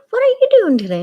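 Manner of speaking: squeaky mock mom asking innocently; high mom imitation. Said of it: "And she's like".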